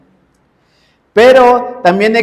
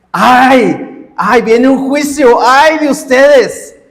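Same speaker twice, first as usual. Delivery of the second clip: higher, louder impassioned preaching; preaching at full force; 5 semitones higher, 3 dB louder than usual. Acted. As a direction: drive each "ay de ustedes" home as a warning of judgment. Preaches Jesus' woes, paraphrased. (predicación apasionada y enérgica)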